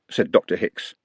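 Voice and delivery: usual speaking voice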